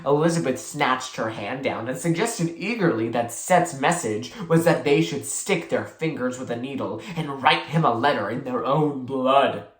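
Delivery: ordinary delivery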